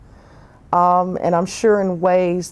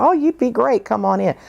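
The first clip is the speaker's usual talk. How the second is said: bright, high, eager invitation; eager phone welcome; 6 semitones higher than usual. Retold or imitated. Agent on the phone inviting her in, high and eager. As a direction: gush a friendly welcome down the phone. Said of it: "One guy said".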